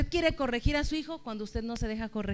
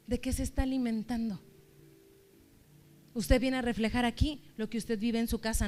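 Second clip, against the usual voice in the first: normal talking voice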